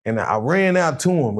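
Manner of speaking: ordinary talk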